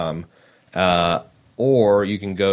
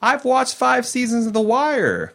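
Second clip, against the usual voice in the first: high-pitched, exasperated disbelief; incredulous protest; 13 semitones higher than usual. Real, not acted. Host scoffing to co-host at the Baltimore accent claim, incredulous. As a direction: protest way up high, as if personally affronted.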